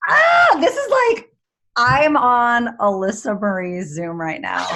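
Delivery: high, loud delighted cry with a laugh; excited squeal. Real, not acted. Guest overjoyed to join host's call, squealing loudly. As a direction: squeal with real joy at being invited.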